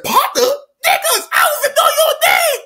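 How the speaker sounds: very high-pitched acted outburst; incredulous. Kid disowning his new robber partners, incredulous yell. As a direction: squawk it back in indignant disbelief.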